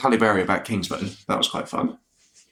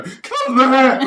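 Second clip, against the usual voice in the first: much higher, squeaky and louder, laughing through it; mocking her own squeaky call echoing the megaphone cut, much higher; really high-pitched voice; 5 dB louder than usual. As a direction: squeal the cut in a tiny panicked falsetto. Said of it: "my really high-pitched voice go".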